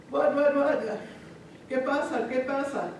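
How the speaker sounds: high, quick repeated questions; urgent questioning. Acted. Father to his running children, urgent questioning. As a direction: fire questions in mock alarm.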